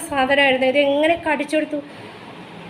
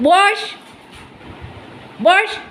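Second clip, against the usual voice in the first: firm dog command; 4 semitones higher than usual. Real, not acted.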